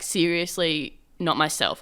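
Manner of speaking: normal talking voice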